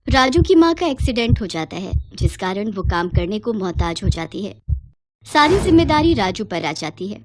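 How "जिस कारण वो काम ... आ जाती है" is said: plain reading voice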